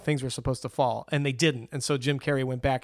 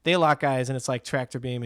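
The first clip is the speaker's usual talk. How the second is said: ordinary delivery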